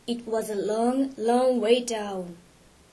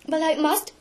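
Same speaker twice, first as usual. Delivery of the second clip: ordinary delivery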